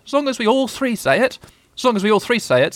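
very high little animal voice; nervous Piglet. A narrator's reading.

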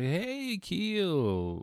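high, giddy call of a nickname; giddy boss. Retold or imitated. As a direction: greet him like an excited boss. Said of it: "he seemed really giddy".

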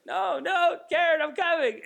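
high, loud mock battlefield cry; panicked shout. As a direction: call out in hammed-up alarm. Mock battlefield rescue of Karen.

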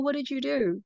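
usual speaking voice

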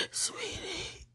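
weeping whisper; barely voiced through tears; whispered tearful apology. Real, not acted.

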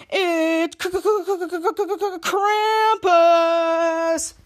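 rattling repeated K into a long name; stuttered announcement. Retold or imitated. Announcing the villain's entrance, dramatic stutter. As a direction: stutter like a stuck record, then draw out Krampus.

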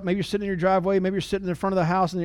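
ordinary delivery

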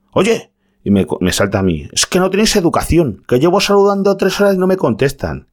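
high, indignant imitation of a rude customer; mock angry customer. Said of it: "Así de malas maneras hacia mí". (imitando con sorna a un cliente indignado, voz muy aguda)

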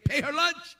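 inner voice of God. Retold or imitated. Preacher voicing God's command in her heart, lifted. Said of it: "God put in my heart again".

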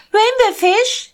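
very high, loud, slow calling; little blue fish. Acted. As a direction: call after him, drawn out.

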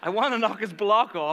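loud, worked-up indignation; heated outburst. Real, not acted. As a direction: let the anger burst out. Heated repeat of the threat.

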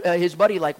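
normal talking voice

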